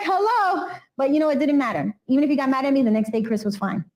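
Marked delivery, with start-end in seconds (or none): 0.0-0.7 high-pitched exasperated "hello"; much higher, as if stating the obvious; incredulous hello. Retold or imitated. Aunt left blamed after meddling, exasperated hello. Mock disbelief at being ignored.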